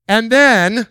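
building suspense; excited and then. Retold or imitated. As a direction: toss it up eagerly. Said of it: "somebody say".

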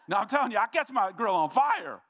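loud, high-pitched comic insistence; emphatic shout. Real, not acted. Preacher insisting to church about his grill, emphatic shout. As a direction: shout it out as an exuberant confession.

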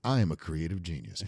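low, soft mock self-importance; smug self-regard. Client thinking he is a creative genius, mock smug. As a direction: mimic a client privately proud of himself. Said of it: "They went in thinking".

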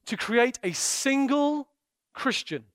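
normal talking voice

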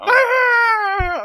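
loud high puppet scream; screaming sailor. Pretend. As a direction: let out a wild sailor shriek. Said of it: "A screaming sailor".

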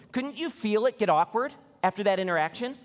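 ordinary talk

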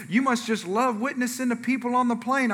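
a hint of an admiring churchgoer; imagined church member. Voicing what the audience assumes.

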